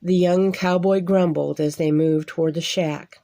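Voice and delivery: ordinary delivery, nothing marked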